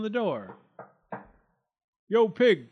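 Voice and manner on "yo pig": loud, high, abrupt wolf call; curt wolf demand; barked. A narrator's reading. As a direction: bark it out with no pleasantries. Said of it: "barked the wolf".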